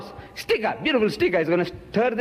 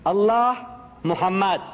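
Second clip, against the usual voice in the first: reading out; slower than usual. Retold or imitated. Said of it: "I'm reading".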